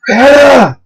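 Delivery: high, drawn-out call; calling her name. A narrator's reading. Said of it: "I barely caught on her name".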